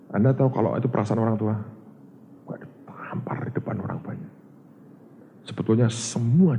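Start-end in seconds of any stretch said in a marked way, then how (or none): 2.4-4.3 hushed, breathy aside; shocked whisper. Real, not acted. Preacher telling the congregation how it felt, whispered. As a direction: murmur it as if it stings.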